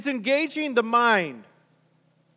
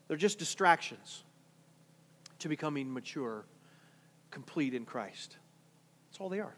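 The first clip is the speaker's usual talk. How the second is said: much softer and lower, letting it land; quiet close; 8 semitones lower, 11 dB quieter than usual. Real, not acted. Preacher softly sums up goosebump churches, low and quiet. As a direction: sink the voice down to settle the point.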